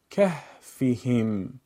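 کهفهم in one slow, connected flow; joined-up reading. Shown as a demonstration. Teacher puts the pieces together: کهفهم slowly as one word. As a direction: run کهفهم together with no breaks. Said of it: "پس از اون به صورت پیوسته قرائت کنید".

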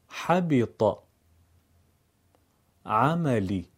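each word slow and clear with a short i; word drill with kasra. Shown as a demonstration. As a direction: model each Quranic word for the class. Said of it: "چند کلمهٔ قرآنی رو با هم تمرین".

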